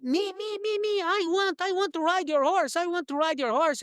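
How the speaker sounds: high, excited shouting to volunteer; eager yelling. Retold or imitated. Boy begging the horse owner to let him ride, high excited yelling. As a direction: yell to be picked, high and eager. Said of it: "I start yelling".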